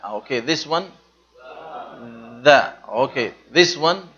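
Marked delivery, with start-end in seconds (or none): 2.4-2.8 ذا said loud, voiced tongue between the teeth; clear letter name. Shown as a demonstration. Teacher models the letter ذا loudly for students. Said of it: "Who can pronounce this letter?".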